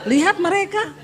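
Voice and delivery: loud laughing telling. Real, not acted. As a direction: talk through real laughter. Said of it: "Saya ketawa".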